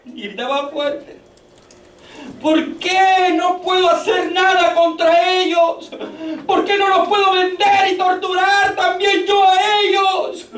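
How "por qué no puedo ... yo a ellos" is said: preacher voicing José's tormented shout; José's cry. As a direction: hurl the questions at heaven. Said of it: "Ese era el grito de José".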